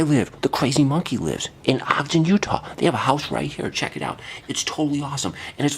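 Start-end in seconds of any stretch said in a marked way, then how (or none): none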